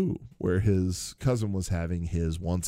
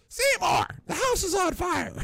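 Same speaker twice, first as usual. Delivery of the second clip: much higher shrill-voiced old mother calling. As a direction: cry out from upstairs like an old mother.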